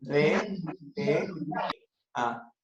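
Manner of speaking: very slow, Ferretería stretched syllable by syllable; split into syllables. Shown as a demonstration. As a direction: draw out Ferretería, each syllable apart. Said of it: "sepárenme en sílabas".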